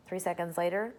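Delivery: usual speaking voice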